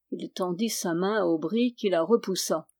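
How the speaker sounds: plain reading voice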